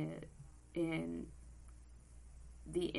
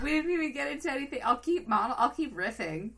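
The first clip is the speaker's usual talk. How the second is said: excited protest; 9 semitones higher, 6 dB louder, faster than usual. Real, not acted. Protesting the podcast intro.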